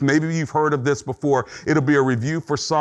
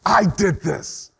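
slow, mild self-accusation, pitched up; mad at oneself; 4 semitones higher, slower than usual. Acted. Preacher acting out anger at oneself, in slow self-blame. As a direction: blame yourself, each word weighed. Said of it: "You're mad at yourself".

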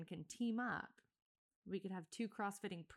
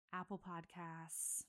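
usual speaking voice

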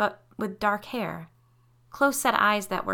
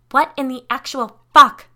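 loud, high outraged yell; 7 semitones higher, 15 dB louder than usual. A narrator's reading. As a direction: shout it in shock, punching each word. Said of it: "Curie yelled".

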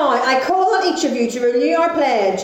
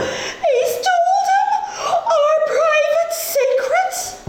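high, slow, outraged wail; scandalised wail; much slower than usual. Acted. Scandalised outburst.

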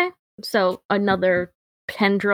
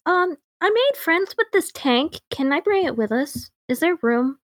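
high, eager little voice; 9 semitones higher than usual. Pretend. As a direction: pitch way up, asking sweetly. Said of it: "Kevin would be like".

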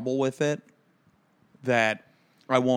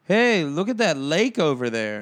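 higher, louder mock excitement; casually excited call-out; distracted driver; 5 semitones higher, 5 dB louder than usual. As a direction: imitate someone marvelling at the view. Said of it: "Then he would go".

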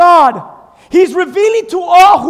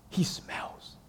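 whisper; 22 dB quieter than usual. Acted. Martha's disgust, whispered.

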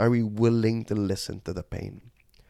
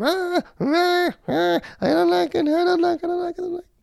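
put-on very high crying child voice; toddler screaming; 4 dB louder than usual. Son on dad's back in the rain, high-pitched toddler wail. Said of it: "he's screaming into my ear".

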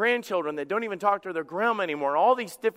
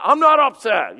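loud, higher-pitched indignant denial; defensive denial; 4 semitones higher, 10 dB louder than usual. Acted. Upset spouse answering the question, loudly defensive. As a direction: shout it back, clearly upset while denying it. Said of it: "you know how they do".

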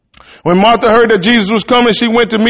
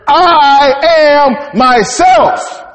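big preaching shout; 7 semitones higher, much slower than usual. Acted. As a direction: belt it out slowly like a proclamation. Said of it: "I had to shout that one".

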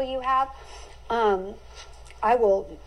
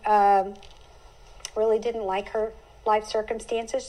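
usual speaking voice

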